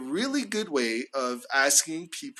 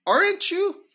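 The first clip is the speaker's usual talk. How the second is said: "Aren't you?" falling on "Aren't", jumping much higher on "you"; rising tag; 4 dB louder than usual. Shown as a demonstration. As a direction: pitch "Aren't you?" high, climbing on "you". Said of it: "falling on the first R and it's rising".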